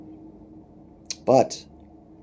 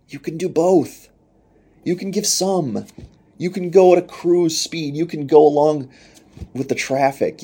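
coaxing, tempting voice pitched higher; tempting devil; 7 semitones higher, 3 dB louder than usual. Retold or imitated. The devil luring people toward a loophole, in a mildly higher coaxing voice. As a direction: sell the easy road like a sly tempter. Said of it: "the devil helped me find that loophole ... To say".

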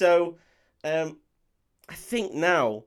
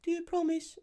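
put-on much higher, softer small child asking; little girl; 13 semitones higher, 7 dB quieter than usual. Newt asks Ripley at bedtime, in a high soft kid voice. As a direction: plead timidly like a small girl. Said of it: "and she's like".

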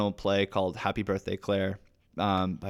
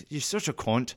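mock British-accented insult; 3 semitones higher than usual. Actor echoing British slang to the host, British accent. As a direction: toss it off like a Brit. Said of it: "more fun to say in a British accent".

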